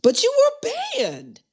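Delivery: very high, sliding down on banned; mock outrage at a friend's ban, squealed high; mock shocked squeal. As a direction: squeal it in play disbelief.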